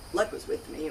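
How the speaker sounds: usual speaking voice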